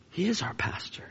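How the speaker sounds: near-whispered solemn statement; hushed emphasis. Real, not acted. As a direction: drop almost to a whisper.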